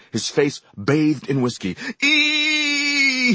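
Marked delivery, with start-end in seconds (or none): 2.0-3.3 high sharp cry of pain; high yelping cry. A narrator's reading. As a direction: yelp as the whiskey hits. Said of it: "he cried".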